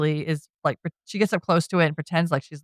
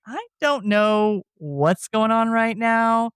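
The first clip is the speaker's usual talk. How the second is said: slow, drawn-out, higher-pitched confusion; imitated bewilderment; 4 semitones higher, much slower than usual. He laughs at Mina's threat, imitated slow bewilderment. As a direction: stretch it out, playing lightly baffled.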